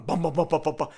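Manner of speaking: loud, rapid bum-bum patter; running-feet noise. Retold or imitated. Storyteller hears someone running in the room, imitated thudding. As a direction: pound out quick footfalls.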